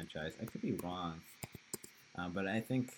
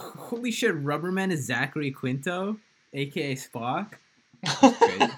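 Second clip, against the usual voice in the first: a much higher, louder astonished outburst; shocked surprise; 7 semitones higher, 9 dB louder than usual. Real, not acted. Host reading that Rubber Man is Zachary Quinto, shocked and loud. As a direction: blurt it out in real disbelief. Reacting to a Google search result.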